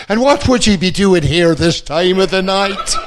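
high, loud Irish brogue from an upstairs window; Irish woman. Retold or imitated. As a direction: call down like a booming Irish landlady. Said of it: "an Irish girl, she said".

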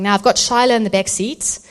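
normal talking voice

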